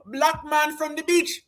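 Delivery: lightly put-on Jamaican lilt; mock Jamaican accent. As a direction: tease with an imitated island accent.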